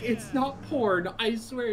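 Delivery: slow, high-pitched pleading denial; imitated protest. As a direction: voice a wife protesting, high and drawn out. Wife's protest acted out.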